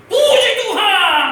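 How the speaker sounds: high, loud, drawn-out praise; jubilant shout. Retold or imitated. Father seeing his healthy baby, jubilant shout. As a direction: burst out in praise at full voice. Retells leaping with joy, shouting.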